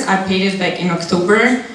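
ordinary delivery

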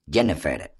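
plain reading voice

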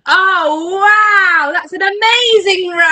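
high, loud wonder; amazed praise. Pretend. Praising the children's dragon roar, amazed. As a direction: gush as if hugely impressed.

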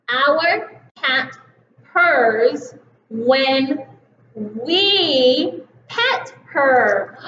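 we pet her said very slowly; slow reading. Shown as a demonstration. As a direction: read each word slowly. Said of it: "will you read it with me".

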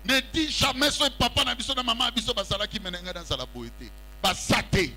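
usual speaking voice

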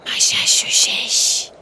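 slow eerie whisper. Pretend. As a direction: whisper it slowly like a spell. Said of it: "ben böyle fısıldayarak".

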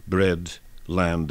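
normal talking voice

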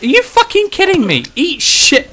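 high, loud cursing; real burst of gamer rage; outraged shriek. Real, not acted. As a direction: screech in disbelief at being hit. Hit by game enemy.

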